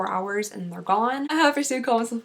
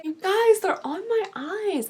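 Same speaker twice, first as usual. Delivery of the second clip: excited; 9 semitones higher than usual. Real, not acted. Excited about the lashes.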